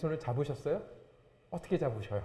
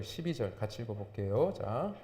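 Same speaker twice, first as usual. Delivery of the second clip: usual speaking voice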